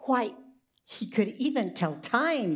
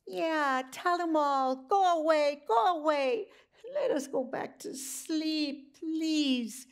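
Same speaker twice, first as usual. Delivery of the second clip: animals' voice; 6 semitones higher than usual. Acted. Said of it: "said all the animals".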